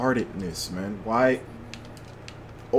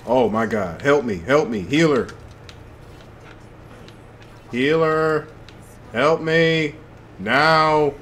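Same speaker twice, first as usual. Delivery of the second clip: calling for healer; 6 semitones higher, 5 dB louder, slower than usual. Real, not acted. Calling for healer mid-fight.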